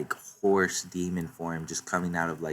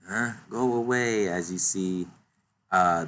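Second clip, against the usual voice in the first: ordinary talk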